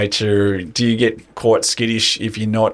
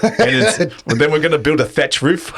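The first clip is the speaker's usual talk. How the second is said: laughing; 6 semitones higher than usual. Real, not acted. Mocking friend's elaborate plan, laughing.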